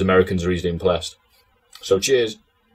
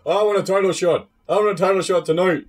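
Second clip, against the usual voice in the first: higher, whiny mock demand for a title shot; Bulldog impression; 8 semitones higher than usual. Mocking Bulldog demanding a title shot, higher whiny imitation. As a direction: whine it like a bumbling wrestler. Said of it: "oh, like".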